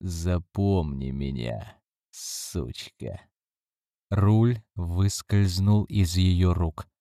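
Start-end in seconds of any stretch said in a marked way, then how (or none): 0.0-3.2 low, slow demonic whisper; deep rough whisper. Acted. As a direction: breathe it into her ear. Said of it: "глубокий, грубый голос зашептал". (глубокий грубый шёпот демона)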